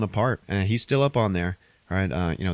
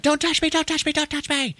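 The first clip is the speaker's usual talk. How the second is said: high, hysterical shrieking imitation; hammed-up panicked repetition; mock screaming. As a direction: scream it in a frantic falsetto. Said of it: "she starts screaming out".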